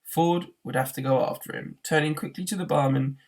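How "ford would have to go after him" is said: plain reading voice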